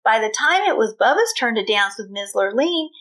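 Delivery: plain reading voice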